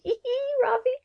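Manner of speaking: girlish "hee hee" giggle, mimicked; giggly. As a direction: titter the name in a high little voice. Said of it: "both kind of giggly".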